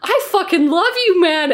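an opponent gushing over a bow emote, high and loud; amazed gushing. Retold or imitated. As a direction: gush like a thrilled stranger. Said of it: "And he was like".

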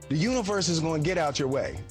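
ordinary delivery

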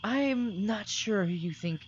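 the spirit answering the party from afar; mystical spirit voice. Acted. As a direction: speak as a spirit bound to its home. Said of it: "And the voice kinda, like, fades away".

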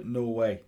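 Norway ending in a full "way"; demonstrating Norway. Shown as a demonstration. Co-host repeating his version to the host, Norway with "way". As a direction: say Norway evenly, rhyming its end with "way". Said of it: "I say Norway, like the country".

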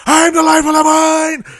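John Goodman roaring at someone in Barton Fink; John Goodman shout. Retold or imitated. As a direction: bellow it like a boisterous screamer. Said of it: "like, shouting at someone at some point".

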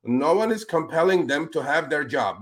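ordinary delivery

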